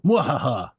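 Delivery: hammy villain laugh, mwahaha; mock mad-scientist cackle; evil cackle. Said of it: "I'm kidding".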